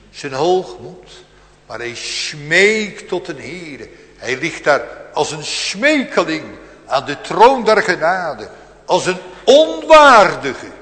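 loud, slow building to a high peak; fervent preaching. Acted. Preacher picturing David at the throne, building fervent preaching. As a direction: build toward onwaardige.

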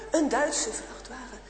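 plain reading voice

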